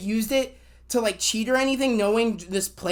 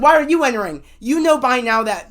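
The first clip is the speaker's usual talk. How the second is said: loud, indignant retort, pitched up at the start; retold telling-off; 2 semitones higher, 7 dB louder than usual. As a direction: scold a teammate in retelling, punching it out.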